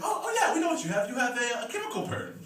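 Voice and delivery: high mock website voice. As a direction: squeak it out like a smug website. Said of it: "WebMD's like".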